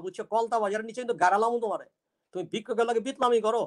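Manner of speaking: put-on fast, rough old Dhaka street talk; beggar Jummon's father voice. A beggar bragging to his nephew, in rapid Dhaka dialect. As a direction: rattle it off like a cheeky beggar. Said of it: "This character is the Jummon's father".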